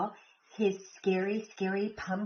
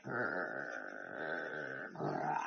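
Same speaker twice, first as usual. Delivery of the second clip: very low, soft rumbling growl; deep growl; 13 dB quieter than usual. A narrator's reading. The scariest sound in the forest, a low soft growl. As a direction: growl like something hidden in the woods. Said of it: "the scariest sound of all".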